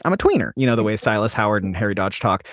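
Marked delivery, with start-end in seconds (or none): 0.0-0.5 lightly voiced, higher quote of a friend. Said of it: "He keeps saying".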